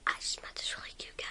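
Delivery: whisper. Pretend. Whispered confiding lines.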